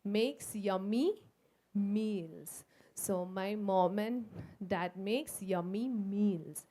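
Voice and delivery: "yummy" drawn out and stressed; slow, savouring "makes yummy meals". Shown as a demonstration. Teacher stressing the M sounds for the children. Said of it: "what does the mama do".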